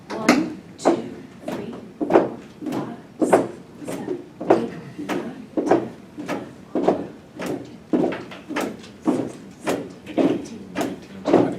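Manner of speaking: whisper counting. Real, not acted. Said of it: "let's whisper count".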